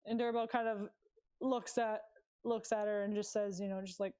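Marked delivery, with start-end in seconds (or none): none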